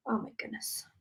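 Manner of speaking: breathless, soft whisper. Real, not acted. Medium stunned after the reading, whispering.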